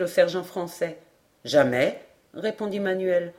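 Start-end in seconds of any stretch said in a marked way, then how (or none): 1.4-2.0 low-pitched one-word answer; low, categorical sergeant; denying sergeant. A narrator's reading. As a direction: deny it in a deep register. Sergeant flatly denies.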